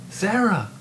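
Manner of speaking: shocked gasp. Acted. Professor startled by the student's answer, crying out her name. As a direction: blurt her name out in alarm. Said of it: "Regina Newman gasped".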